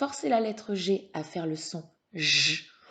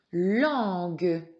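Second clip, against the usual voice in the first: Langue louder and drawn out; emphatic dictation; 5 dB louder than usual. Shown as a demonstration. Teacher has pupil listen for syllable, Langue loud and stretched. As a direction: stretch Langue, sounding the final "gue".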